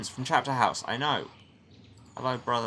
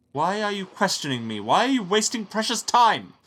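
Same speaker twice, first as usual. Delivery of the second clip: furious, much higher and louder rebuke; angry abbot; 10 semitones higher, 9 dB louder than usual. Acted. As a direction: bark down at the questioning monk. Abbot angrily berates monk.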